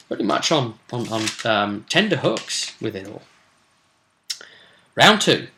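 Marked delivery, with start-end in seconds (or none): none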